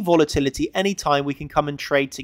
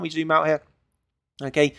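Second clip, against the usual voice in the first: ordinary talk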